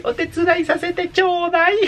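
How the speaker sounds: squeaky-high, slow girly imitation; vocecita de pito. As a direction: pipe it up like an anime girl. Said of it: "le pone la vocecita ya un poco como de pito". (vocecita de pito, muy aguda)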